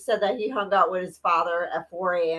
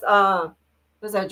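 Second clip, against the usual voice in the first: ordinary talk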